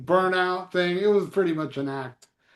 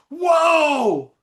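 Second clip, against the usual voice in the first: high, loud startled shout; surprised whoa; 9 semitones higher, 5 dB louder than usual. Retold or imitated. [name] startled at meeting [name], shouting whoa in surprise. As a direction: blurt it out like he's stunned.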